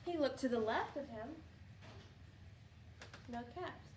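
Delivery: ordinary delivery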